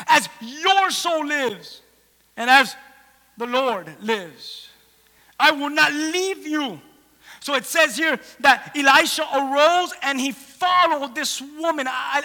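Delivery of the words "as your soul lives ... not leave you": slow, deliberate, resolute vow; measured, forceful declaration with pauses; emphatic oath. Acted. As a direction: land each word as a refusal to budge. Acting mother's solemn defiant oath.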